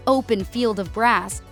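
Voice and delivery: usual speaking voice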